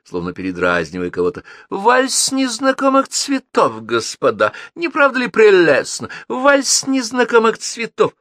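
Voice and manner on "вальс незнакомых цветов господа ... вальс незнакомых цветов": mock a pompous society hostess; pompous affected mimicry. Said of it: "напыщенно и жеманно произнесла, словно передразнивая".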